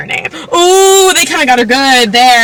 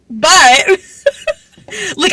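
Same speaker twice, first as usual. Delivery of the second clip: laughing; 8 semitones higher than usual. Real, not acted. Laughing mid-sentence at the book.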